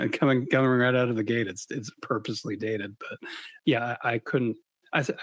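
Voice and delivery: ordinary talk, nothing marked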